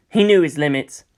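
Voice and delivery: plain reading voice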